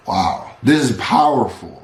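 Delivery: ordinary talk, nothing marked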